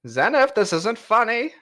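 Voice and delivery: high, theatrical rogue greeting; swashbuckling cat rogue. Acted. GM voicing a rogue greeting Zenith, high and theatrical. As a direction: play the dashing cat thief, grandly. Puss annoyed.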